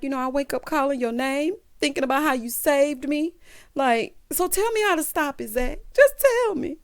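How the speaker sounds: high voice climbing toward the end; mock desperate pleading. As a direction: imitate a lovesick woman begging. Retold breakup, woman pleading.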